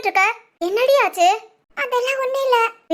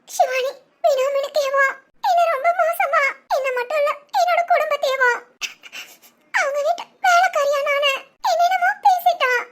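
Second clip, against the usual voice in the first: broken sobbing, higher voice; 5 semitones higher than usual. Acted. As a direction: sob out the hurtful words. Hurt girl sobbing to friend. (தோழியிடம் அழுதபடி சொல்லும் பெண், விம்மல் குரல்)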